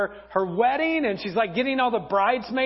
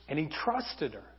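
ordinary delivery

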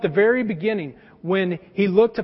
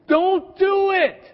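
higher and louder, very slow, do punched; emphatic warning; 9 semitones higher, 5 dB louder, much slower than usual. Retold or imitated. A friend warns David over coffee: higher, louder, very slow. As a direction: plead him off it, word by word.

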